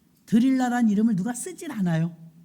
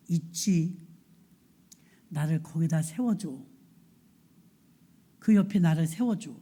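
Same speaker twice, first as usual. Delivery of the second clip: low-pitched, weighty voice of a captive hero; blind Samson's request; 5 semitones lower than usual. Acted. Samson asks the boy guiding him, low and grave. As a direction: ask a boy for help, low and measured. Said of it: "부축해서 오는 소년에게 말합니다".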